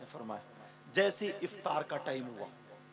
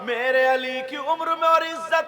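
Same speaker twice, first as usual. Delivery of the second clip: fervent prayer; 10 semitones higher, 15 dB louder, slower than usual. Acted. Fatima's prayer retold.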